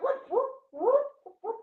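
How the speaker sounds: high rising whoop whoop like a police siren; siren imitation. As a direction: mimic the cop siren.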